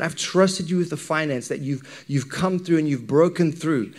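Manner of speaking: ordinary talk, nothing marked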